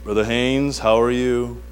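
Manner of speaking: lightly imitated soothing counselor; low, measured greeting, lightly put on; a hint of a kindly pastor's set manner; calm older mentor. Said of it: "He always does the same thing".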